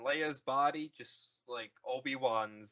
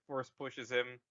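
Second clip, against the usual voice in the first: normal talking voice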